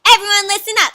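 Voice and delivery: high, loud announcement; tiny critter taking charge loudly; bossy little mouse. Acted. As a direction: call the crowd to attention. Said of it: "says one of the little mice".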